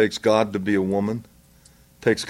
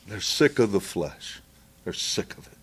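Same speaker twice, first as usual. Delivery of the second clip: quiet; 3 semitones lower, 8 dB quieter than usual. Acted. Quiet emphatic sermon aside.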